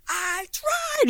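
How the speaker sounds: high screeched imitation of a scream; mock scream. Retelling the girlfriend screaming at her boyfriend: high mock scream. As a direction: shriek it way up high. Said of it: "she's, like, screaming at the top of her lungs".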